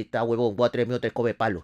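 grave, como pensando para sí; estratégico, por lo bajo. Acted. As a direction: dilo bajando el tono, calculador. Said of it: "El Trax estratégicamente dice". (imitación de vecino calculador, tono grave)

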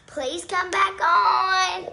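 drawn-out, pleading call; slow pleading. Real, not acted. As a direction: beg them back slowly. Pleading viewers to return.

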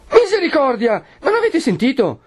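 high-pitched, agitated outcry; alarmed exclamation. A narrator's reading. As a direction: exclaim in alarm, struggling to get away. Perpetua alarmed, breaking free. (esclamazione allarmata, molto acuta)

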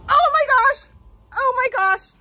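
high, loud cry of alarm; panicked. Real, not acted. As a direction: cry out in real fright. Spider off the wall.

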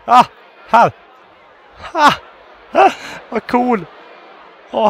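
high, loud, starstruck shouting; frantic excitement. Real, not acted. Player tracking the celebrity and fans, frantic high shouting. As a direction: shout in amazement as you lose and find him.

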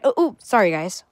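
ordinary talk

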